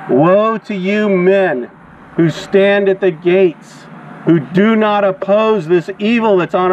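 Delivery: slow, weighty proclamation, each word spaced out; drawn-out denunciation from the pulpit; slow prophetic woe. Real, not acted. Street preacher pronouncing woe.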